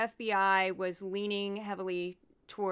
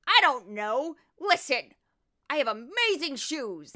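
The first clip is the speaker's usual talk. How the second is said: higher, louder, blustery TV judge voice; Judge Jeanine impression; 5 semitones higher, 6 dB louder than usual. Host mocking Judge Jeanine for his podcast listeners, loud and higher. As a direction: lampoon her as a loud, brash TV host. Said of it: "I'll do my Judge Jeanine right now".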